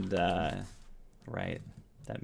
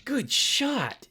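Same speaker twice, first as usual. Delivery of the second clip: high, loud shout of praise; impressed comrade calling out a compliment; Locke; 4 dB louder than usual. Acted. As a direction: call it out, pitched way up. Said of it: "Locke is like".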